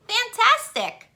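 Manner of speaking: high, thrilled fantastic; delighted. Acted. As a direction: cheer him on. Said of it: "said Elizabeth".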